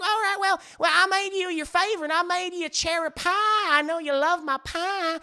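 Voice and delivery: put-on high Southern-accented wife; Bridget. Wife tells husband about his cherry pie, high Southern accent. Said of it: "She has a very deep Southern accent".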